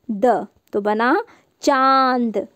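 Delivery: चांद drawn out slowly with a nasal आं; slow nasal demo. Shown as a demonstration. Teacher builds a word for children, slow and nasal. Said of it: "यहाँ पे आ, इसकी आवाज़ आ ऐसे आएगी".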